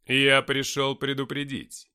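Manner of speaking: loud, slow, ominous proclamation; menacing voice. A narrator's reading. Lucifer warning the men of danger, menacing voice. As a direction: boom it out slowly as a warning. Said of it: "сказал Люцифер грозным голосом".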